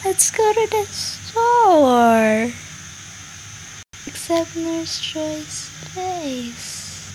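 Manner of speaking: hammed-up tour guide voice; mock cheerful guide. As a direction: lure the viewers along sweetly. Sing-song mock tour voice.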